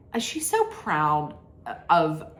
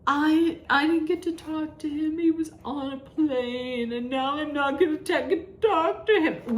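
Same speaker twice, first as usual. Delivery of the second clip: mock whine; 7 semitones higher than usual. Mocking Meredith about her son.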